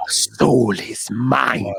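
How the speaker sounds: very slow, low echoing villain; Shang Tsung voice. Retold or imitated. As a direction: stretch each word, menacing and hollow. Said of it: "as he said in the Mortal Kombat movie".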